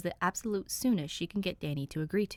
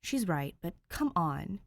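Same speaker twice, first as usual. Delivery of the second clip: plain reading voice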